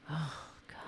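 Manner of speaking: whispered weary groan to himself; exasperated whisper. Real, not acted. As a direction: breathe it out in despair. Exasperated aside after the news clip.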